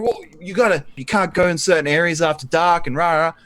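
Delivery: 1 mocking warnings.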